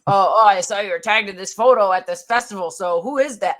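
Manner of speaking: loud, prying accusation; jealous ex. Retold or imitated. Mocking a jealous ex about a festival photo, loud prying. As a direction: quiz them like a jealous partner. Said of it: "have been like".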